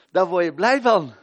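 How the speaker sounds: loud and high, ringing out; exclaimed joy. Acted. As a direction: throw it out with delight. Repeated answer, first loud.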